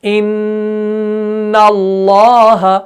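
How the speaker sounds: इन्नल्लाह slow, the nn held long and humming through the nose; drawn-out ghunna. Shown as a demonstration. Teacher demonstrating nasal ghunna for students. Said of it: "ज़ुबान ऊपर तालों के साथ चिपक रही है".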